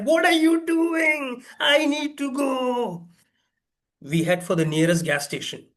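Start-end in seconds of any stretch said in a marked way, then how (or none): 0.0-3.1 high, slow frantic protest. Retold or imitated. Passenger yelling at stalled rocket driver, high and frantic. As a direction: plead with the driver in panic.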